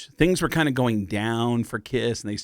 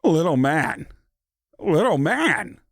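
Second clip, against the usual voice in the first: higher, slow, oddly paused phrasing; Christopher Walken impression; 5 semitones higher than usual. Shown as a demonstration. Warming up into a Christopher Walken voice, slow and higher. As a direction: find Walken's voice through the phrase. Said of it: "with Christopher Walken, it started out with me going".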